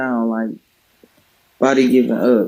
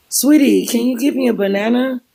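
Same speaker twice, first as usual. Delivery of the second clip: put-on much higher, sweet old-lady voice asking nicely; gentle little granny request, pitched way up; sweet old wife; 11 semitones higher than usual. Demented wife asks for banana.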